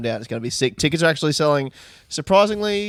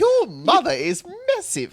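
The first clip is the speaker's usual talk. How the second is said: high, slow put-on voice for the joke; mock yo mama voice.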